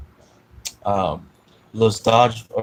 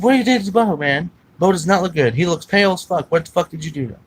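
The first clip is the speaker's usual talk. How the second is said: raised, high-pitched, loud interrogation; angry accusing dad; 8 semitones higher, 6 dB louder, faster than usual. Retold or imitated. Dad confronting his son over the sick dog, angrily accusing. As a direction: demand answers as an outraged dad. Acts out furious dad's accusation.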